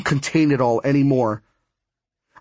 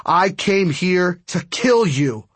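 slow, higher, forceful vow; thundering threat; 5 semitones higher, slower than usual. A narrator's reading. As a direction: deliver the threat slowly, with mild thunder. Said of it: "my voice thundered".

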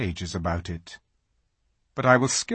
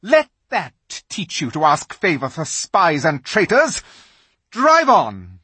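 higher, loud, scornful command; witch queen; 6 semitones higher, 8 dB louder than usual. A narrator's reading. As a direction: scold the traitor then order off. Witch strikes Edmund.

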